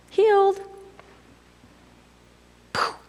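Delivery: high, bright burst of discovery; high excited exclamation. Acted. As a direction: pop the word up high with delight. Jesus discovers his power.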